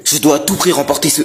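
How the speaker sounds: loud, dramatic self-pep talk; thinking out loud. Acted. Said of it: "Ah, il continue".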